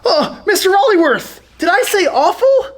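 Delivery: mock-nervous cover-up; nervous backpedaling. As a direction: squirm out of it in front of teacher. Caught insulting the teacher.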